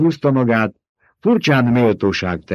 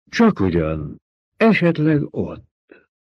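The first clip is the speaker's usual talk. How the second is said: musing; 7 semitones higher, slower than usual. A narrator's reading. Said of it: "mondta tűnődve a báró".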